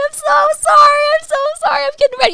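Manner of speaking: very high, loud pleading, hammed-up; frantic retold apology. Oversleeper apologizing while getting ready, mock frantic wail. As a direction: plead in a panicked squeal.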